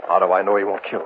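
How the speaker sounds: ordinary talk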